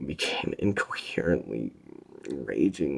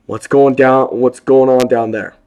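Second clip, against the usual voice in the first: loud, higher, urgent yell; shout; 11 semitones higher, 18 dB louder than usual. A narrator's reading. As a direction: shout down the stairwell. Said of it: "Dante shouted".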